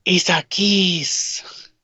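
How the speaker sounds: high, long drawn-out gushing; playful high swooning excitement; excited squeal. Acted. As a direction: squeal it slowly, stretching every word. Mock-excited reaction to scripted kiss.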